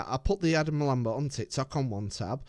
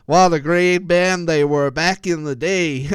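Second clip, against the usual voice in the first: louder hammed-up American accent, laughing round it; mock American accent; 4 semitones higher, 8 dB louder than usual. Imitating an American Queen fan, mock American accent. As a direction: ham up an American fan gushing about Queen. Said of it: "Here's American accents again".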